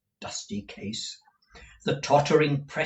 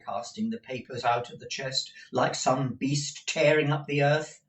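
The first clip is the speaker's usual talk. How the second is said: plain reading voice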